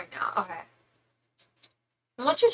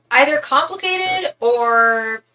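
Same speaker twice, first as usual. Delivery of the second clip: drawn-out musing; 5 semitones higher, 8 dB louder, much slower than usual. Acted.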